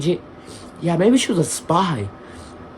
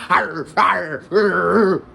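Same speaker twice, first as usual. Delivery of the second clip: playful growling arr as he bites; mock growl bite; much slower than usual. Biting the girlfriend, a mock growl. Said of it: "I said".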